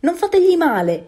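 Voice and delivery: high, loud protective cry; furious shout. A narrator's reading. Mother duck defending her duckling from attack, furious shout. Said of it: "gridò la mamma anatra furiosa".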